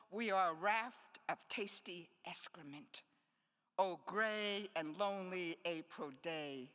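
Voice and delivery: measured poetry reading voice; poem reading. Acted. Poet to audience, reading short poems aloud. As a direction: read each line like a small riddle.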